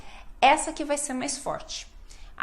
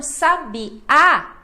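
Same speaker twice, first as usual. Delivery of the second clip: "sabiá" with the final á louder and drawn out; stress on last syllable; 4 semitones higher, 7 dB louder than usual. Shown as a demonstration. Teacher showing learners where Portuguese stress falls, stressed final á in "sabiá". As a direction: lean slowly on the á of "sabiá". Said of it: "a sílaba mais forte é a última".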